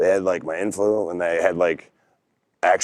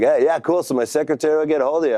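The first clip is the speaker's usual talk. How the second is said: higher and fast, brushing it off; breezy recruiter; 8 semitones higher than usual. Retold or imitated. Recruiter wrapping up the call, quick and much higher. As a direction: rattle it off like a busy exec. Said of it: "He's like".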